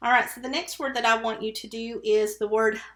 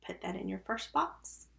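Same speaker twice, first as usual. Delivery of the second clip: usual speaking voice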